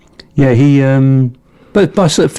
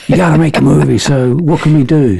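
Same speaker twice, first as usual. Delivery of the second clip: put-on higher, light Canadian accent; 4 semitones higher than usual. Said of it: "it's my Canadian accent".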